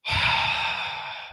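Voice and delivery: long breathy exhale; heavy sigh. Real, not acted. Exasperated before his rant.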